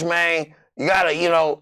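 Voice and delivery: usual speaking voice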